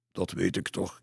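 usual speaking voice

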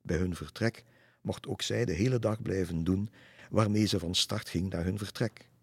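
ordinary delivery

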